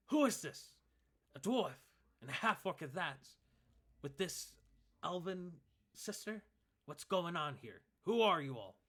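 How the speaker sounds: loud, high, suspicious challenge; demanding elf. Acted. Hidden elf archer challenging the party, loud and suspicious. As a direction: call out sharply from hiding.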